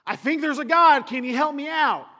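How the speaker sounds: high, loud, pleading voice; pleading sailors. Retold or imitated. Desperate sailors calling out, loud and high. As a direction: beg a god you barely know for help.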